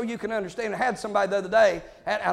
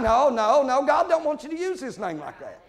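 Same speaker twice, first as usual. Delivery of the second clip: usual speaking voice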